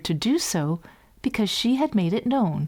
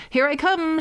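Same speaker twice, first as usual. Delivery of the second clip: shouted; 9 semitones higher than usual. A narrator's reading. Hans announces himself to his brothers, shouting. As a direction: call it out gleefully. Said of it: "shouted Hans Clodhopper".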